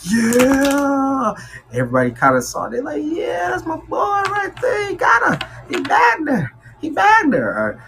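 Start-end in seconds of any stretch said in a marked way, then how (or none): 0.0-1.3 cheering friend. Pretend. Friends cheering the new couple. 3.0-7.5 high, shouted crowing from a proud friend; hyped cheering buddy. Pretend. Friends cheering Aquarius for getting the date, high shout.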